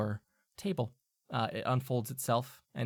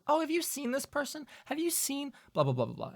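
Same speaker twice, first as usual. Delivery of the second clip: small asking voice; 13 semitones higher, 3 dB quieter than usual. Retold or imitated. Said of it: "You hear about someone".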